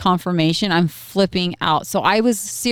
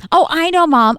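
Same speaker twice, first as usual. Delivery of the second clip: mock know-it-all kid; 10 semitones higher, 4 dB louder than usual. Son Tucker blurting the secret.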